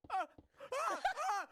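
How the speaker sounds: short, high shrieks of panic; startled screams in separate bursts; panicked yell. Retold or imitated. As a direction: imitate someone waking up yelling in terror. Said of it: "like, yelling on the plane".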